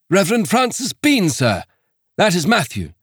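a shaken reverend introducing himself; earnest missionary. Acted.

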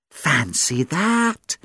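very slow, unhurried reply; a slow, idle "fancy that" from the cat; languid cat drawl. Acted. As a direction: draw it out like a lazy cat. Cat Millie replies.

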